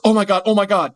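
hammed-up parental panic; mock panic. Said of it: "which is".

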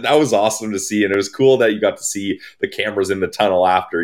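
ordinary delivery

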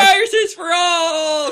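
very high, drawn-out comic cry; hammed-up shrieking announcement; mock proclamation. Joker declaring viruses for everyone, hammed-up proclamation.

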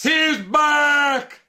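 high-pitched hyped yell; celebratory shout. Pretend. Co-host hailing the returning wrestler, high excited shout. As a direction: holler it like an overjoyed fan.

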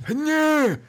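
high scoffing "nö"; disgusted squeak. Real, not acted.

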